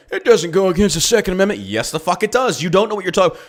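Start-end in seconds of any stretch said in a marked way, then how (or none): 0.1-1.6 mocking joker. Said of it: "like this joker, saying".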